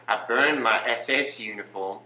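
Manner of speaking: matter-of-fact mock Australian accent; Australian Nazi. Nazi excusing himself as following orders, mock Australian accent. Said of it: "You're an Australian Nazi".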